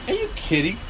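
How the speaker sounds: high, incredulous imitation of a European friend; mimicked European scoff; mock European disbelief. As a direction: scoff at the idea, mimicking them. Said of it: "all the Europeans go".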